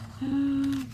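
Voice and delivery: sharp, high breathy gasp; startled gasp. Acted. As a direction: gasp at the dragon. Sees dragon behind goblin.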